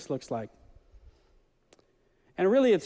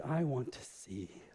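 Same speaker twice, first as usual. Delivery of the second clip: soft, slow, much lower plea; quiet plea; 6 semitones lower, 11 dB quieter, slower than usual. Acted. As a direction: ask it softly and humbly. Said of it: "the blind man said".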